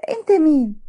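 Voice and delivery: cold. A narrator's reading. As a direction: brush him off with mild coldness. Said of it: "وميرا برود بصيت له". (ببرود)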